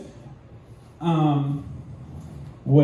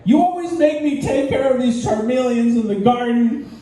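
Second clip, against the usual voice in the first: mock argument; 6 semitones higher than usual. Acted marital fight as Ash.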